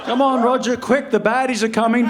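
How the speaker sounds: put-on high, loud, giddy kid voice with laughs; a grown man playing pretend like a child; playful kid at play. Comedian playing fort with his mate, excited kid voice.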